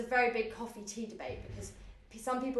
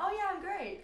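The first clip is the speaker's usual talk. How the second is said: cheery; 10 semitones higher than usual. Shown as a demonstration. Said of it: "instead of being like".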